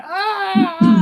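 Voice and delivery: sharp high cry of agony; scream of pain. Acted. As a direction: let out a shrill pained scream. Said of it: "he's just screaming in pain".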